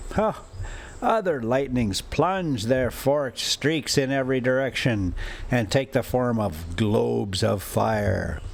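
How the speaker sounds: ordinary delivery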